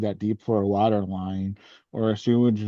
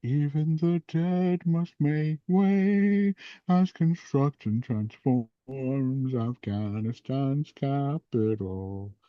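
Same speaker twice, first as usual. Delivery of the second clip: gravitas announcer voice; 3 semitones higher, slower than usual. Shown as a demonstration. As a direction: draw out every word with mock gravity. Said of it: "read this in very, uh, gravitas ... announcer voice".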